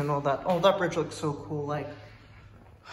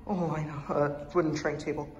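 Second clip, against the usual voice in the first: ordinary delivery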